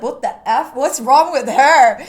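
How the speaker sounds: loud, dragged-out mock bewilderment; mock disbelief. Schoolmates baffled by the newcomer's way of talking, mock disbelief. Classmates reacting to her new slang.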